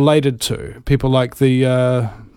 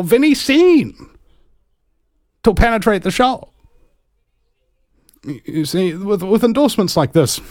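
Bill character, hesitant schemer; 5 semitones higher than usual. Acted. Bill presenting his plan to fellow globalists, high halting voice. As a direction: answer haltingly in a higher put-on voice.

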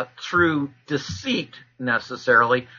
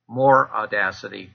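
normal talking voice

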